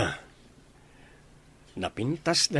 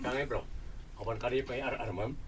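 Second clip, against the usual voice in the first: hushed gossip; 9 dB quieter than usual. Acted. Friends trading secrets about a girl, hushed. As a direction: keep it soft and secretive.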